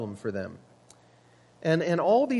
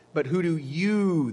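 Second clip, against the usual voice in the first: slow down and draw out "you"; YOU held long as the key word; stress on YOU; 2 semitones higher than usual. Shown as a demonstration. Pastor shows the Greek emphasis in Jesus' question, stress on YOU. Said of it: "the emphasis is on you".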